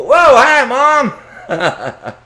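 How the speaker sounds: high, loud startled squeak with a laugh; caught-out son. Acted. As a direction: blurt it like a teen caught in the act. Caught son greets mom.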